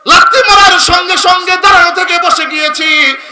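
loud, high-pitched chanted lament; anguished cry. Retold or imitated. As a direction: cry out the pain in a soaring chant. Salman cries of pain from the kick. (চড়া সুরে যন্ত্রণার আর্তনাদ)

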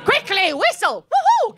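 high whooping whistle call; mock alarm call. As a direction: summon help urgently. Calling the nuns.

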